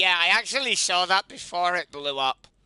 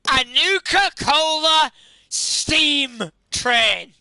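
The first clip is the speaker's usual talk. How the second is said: higher, louder, hyped announcement; excited; 7 semitones higher, 7 dB louder, much slower than usual. Real, not acted. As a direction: build up to a shouted reveal. Hyped reveal of new build.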